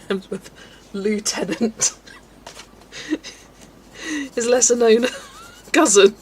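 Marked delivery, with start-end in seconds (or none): 0.9-2.0 Lieutenant drawn out slowly, "loo-tenant"; Lieutenant said slowly as a joke. Shown as a demonstration. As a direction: stretch out Lieutenant. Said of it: "The lesser known tenant".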